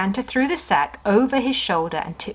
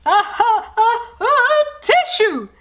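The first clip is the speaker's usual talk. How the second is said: much higher, loud build to a sneeze; 5 dB louder, slower than usual. Acted. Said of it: "sneezed Santa".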